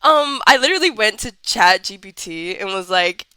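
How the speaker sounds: ordinary delivery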